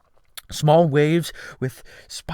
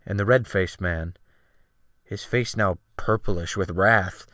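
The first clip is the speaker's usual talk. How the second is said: usual speaking voice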